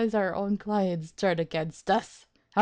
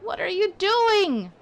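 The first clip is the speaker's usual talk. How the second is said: higher, louder disbelief; you're ruining everything, louder; exasperated; 9 semitones higher, 5 dB louder, slower than usual. Real, not acted. Player yelling at his client, higher and louder.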